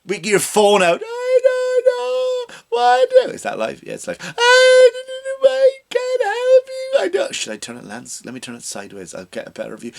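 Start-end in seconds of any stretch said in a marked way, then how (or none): 1.0-3.3 high, slow, sobbing wail. Retold or imitated. Comic mocking a celebrity filming herself crying, high wail. 3.3-4.2 mock whispered aside. Aside as [name] checking phone. 4.2-7.4 high, slow, wailing fake sob. Comic mocking a celebrity's crying video, wailing high and slow. 7.4-9.9 mock hushed aside. Aside as [name] adjusting phone.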